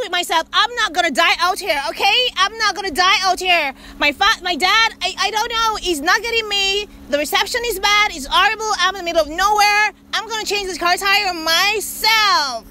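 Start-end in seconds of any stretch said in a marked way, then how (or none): none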